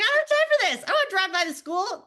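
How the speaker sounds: high, loud hammed-up rant; mock angry husband. Husband ranting about the errand, loud and high. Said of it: "He's like".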